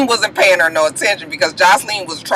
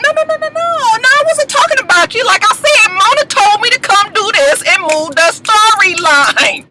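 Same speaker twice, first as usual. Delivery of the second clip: high-pitched imitation of a frantic denial; Carly Red; 12 semitones higher than usual. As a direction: backpedal in a high mock voice, stretching the end. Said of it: "Carly Red was like".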